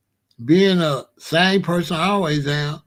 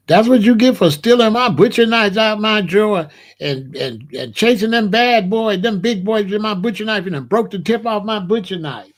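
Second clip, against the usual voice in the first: louder, higher scolding mama voice; scolding mother; 5 semitones higher, 5 dB louder than usual. Retold or imitated. Mother scolding her stabbed son, louder and higher. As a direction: lay into him like an annoyed mother.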